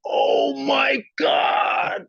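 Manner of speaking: slow, high, loud cry of disbelief; drawn-out amazed cry; stunned shout. Retold or imitated. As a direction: gasp it out like you can't believe it. His stunned reaction retold.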